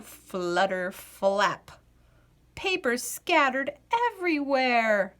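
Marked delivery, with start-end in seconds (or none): none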